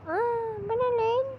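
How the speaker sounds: startled high squeal; shocked cry. Real, not acted. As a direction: yelp it, caught off guard. Startled by something in game. (şaşkın, tiz bir çığlıkla)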